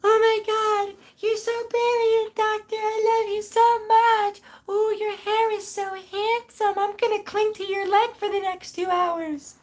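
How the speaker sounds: squeaky, very high fangirl voice, slowed and gushing; deliberately bad Mimi impression. Streamer doing a purposely awful Mimi impression for her voice actor, very high squeak. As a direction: ham up a terrible Mimi on purpose. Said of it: "doing an impression of her".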